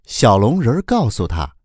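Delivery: ordinary delivery, nothing marked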